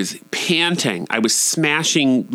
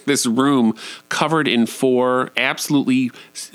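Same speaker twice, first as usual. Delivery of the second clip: ordinary delivery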